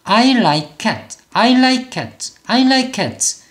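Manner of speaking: I like cat in three clean syllables; like and cat end on the consonant; one-syllable like. Shown as a demonstration. Teacher drills the corrected reading, one-syllable like. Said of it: "이름절로 소리를 내보면".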